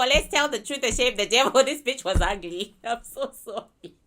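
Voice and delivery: ordinary talk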